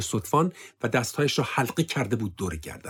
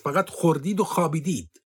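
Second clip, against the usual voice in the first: higher, sneering rebuke; contemptuous accusation; 6 semitones higher than usual. Acted. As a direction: stress on و in خوردید و خوابیدید with a falling end. Officer accuses them of idleness.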